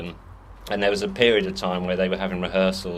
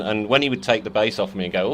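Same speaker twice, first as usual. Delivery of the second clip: usual speaking voice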